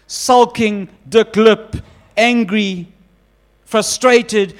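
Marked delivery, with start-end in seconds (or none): none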